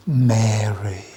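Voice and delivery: a lightly voiced Jesus, low and soft; Jesus softly calling Mary; tender naming. A narrator's reading. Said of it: "finally says this one word".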